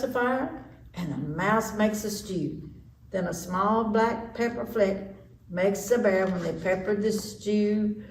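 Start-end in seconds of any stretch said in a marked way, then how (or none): none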